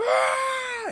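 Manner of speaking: high, loud furious roar; battle yell. Acted. Shirtless Brody charging at Sniffles, a high loud yell. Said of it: "like, go".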